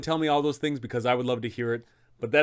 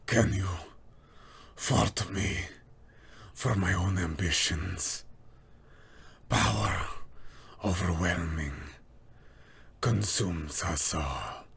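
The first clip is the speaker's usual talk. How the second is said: dying vampire's last warning; low, slow words with long pauses; Strahd; 5 semitones lower, 3 dB quieter, slower than usual. Acted. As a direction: let each word fade out like a final breath. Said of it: "let out one last warning".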